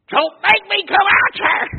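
loud, high warning shout; daddy's warning. Retold or imitated. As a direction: threaten the dogs from the window. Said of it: "he said".

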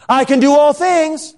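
high, mock-grand proclamation; hammed-up victorious cry; triumphant declaration. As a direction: proclaim it high, as if that were everything. Repeats the half-finished verse grandly.

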